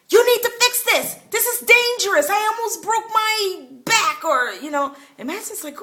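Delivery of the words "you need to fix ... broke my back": high, loud retelling of an irate neighbor's yell; imitated angry yelling. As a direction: yell it like the furious neighbor complaining. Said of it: "he starts yelling at my husband".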